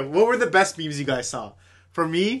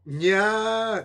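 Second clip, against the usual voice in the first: cutesy nya speak; 6 semitones higher than usual. Pretend. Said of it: "you should start saying".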